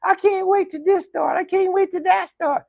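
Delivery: high, excited fan voice, teasing; mock eager fan. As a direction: squeal it like an impatient fan. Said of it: "You guys tell me all the time".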